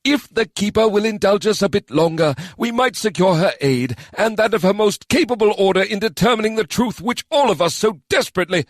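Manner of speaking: normally calm man losing patience. Acted. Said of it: "his raised voice echoed through the round hall".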